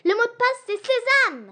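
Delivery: high, loud triumphant "sésame"; excited answer. Acted. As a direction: proclaim the password. Answer shouted.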